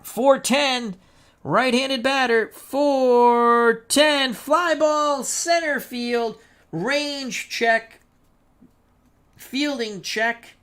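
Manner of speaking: high, suspenseful announcer's call; tense play-by-play. Pretend. Announcer calls a possible game-winning fly ball, tense high play-by-play. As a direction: hold it high, drawing out the key calls.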